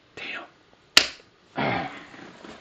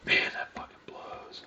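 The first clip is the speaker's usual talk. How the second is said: soft whispered disgust; quiet whispered frustration; whispered grumble; 10 dB quieter than usual. Real, not acted. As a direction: whisper it to yourself, fed up. Muttering after missing the move.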